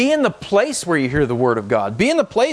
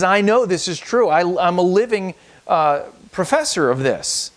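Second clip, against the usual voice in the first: raised, proclaiming scripture voice; scripture reading; 5 semitones higher than usual. Acted. Pastor reading scripture aloud to the congregation, proclaiming. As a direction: read the verse out as a proclamation.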